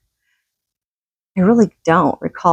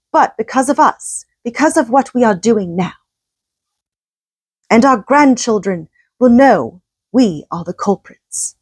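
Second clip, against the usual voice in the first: a higher, lightly put-on English accent throughout; 4 semitones higher than usual. Host reading Johnson's climate warning in an English accent. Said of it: "I'm gonna do my English accent again".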